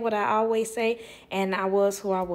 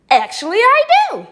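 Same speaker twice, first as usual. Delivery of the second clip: much higher and louder, a squealed yes; emphatic yes; 13 semitones higher, 12 dB louder than usual. Retold or imitated. Answering the mother about spirits, with an excited high yes. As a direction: shout it with total conviction.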